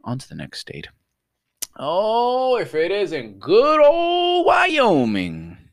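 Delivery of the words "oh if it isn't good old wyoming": high, loud, drawn-out fanfare; mock grand greeting. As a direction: stretch it out like greeting an old pal. Mock grand greeting of next state.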